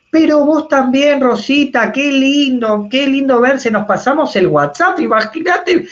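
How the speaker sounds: put-on high, excited old-lady voice, gushing; chatty grandmother. Speaker voicing a grandmother excitedly telling her grandson: gushing, high. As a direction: play the thrilled grandma retelling her news.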